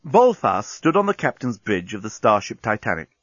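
plain reading voice

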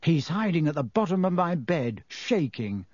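usual speaking voice

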